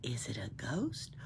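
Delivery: slow, hushed, breathy spooky whisper; low spooky whisper. A narrator's reading. Pippi teasing the children at the door, in a slow spooky whisper. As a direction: hiss it low like a ghost behind the door. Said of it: "From inside came a low voice".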